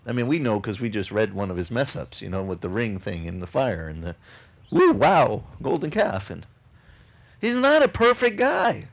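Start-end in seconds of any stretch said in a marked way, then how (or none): none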